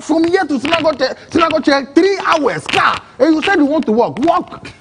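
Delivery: high, fast incredulous driver voice; mocking driver. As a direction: play the driver scoffing at walking. Retold driver mocking walking plan.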